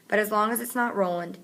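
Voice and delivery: ordinary delivery, nothing marked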